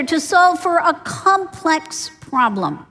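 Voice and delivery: slow, with "problem" hit firmly; slow down and land on "problem"; stress on problem. Shown as a demonstration. Teacher defining innovation, stressing "problem". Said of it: "emphasis on the word problem".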